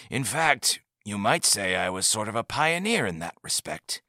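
a hint of a soft elderly leader; lightly voiced old man. A narrator's reading. Old leader gently teasing the visitor, lightly aged voice.

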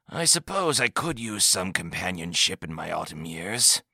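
a hint of a soft elderly leader; lightly voiced old man. A narrator's reading. Old leader gently teasing the visitor, lightly aged voice.

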